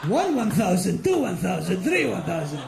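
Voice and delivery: put-on counting steps in a light Arabic accent. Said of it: "in an Arabic accent".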